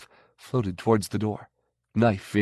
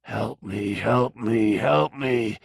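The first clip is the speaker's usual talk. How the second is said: eerie plea; slower than usual. A narrator's reading. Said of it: "said Christophorus from the darkness".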